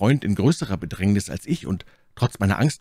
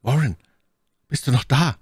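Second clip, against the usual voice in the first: raised, higher, questioning call; 6 semitones higher, 4 dB louder than usual. A narrator's reading. Narrator calling Warren over the telephone, anxious calling out. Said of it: "Wieder rief ich".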